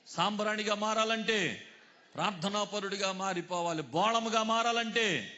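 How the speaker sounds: loud, high call-and-response preaching; full-force preaching. Real, not acted. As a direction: drive each phrase out for the crowd to finish. (ఆవేశంగా, గట్టిగా ప్రసంగం)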